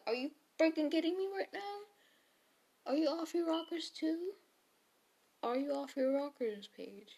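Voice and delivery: mock exasperation. Retelling Jake's exasperated reaction.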